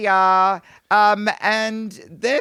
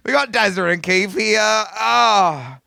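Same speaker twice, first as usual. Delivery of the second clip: ordinary delivery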